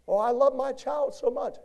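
high, loud sappy parent; high loud mock gushing parent. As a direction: parody a doting parent. Preacher mocks parent's claim.

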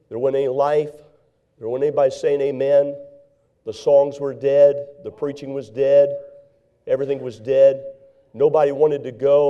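loud, emphatic preacher's cadence with long pauses; preaching. Acted. Evangelist preaching to the church about dying churches, loud and emphatic. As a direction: hammer each line to the congregation.

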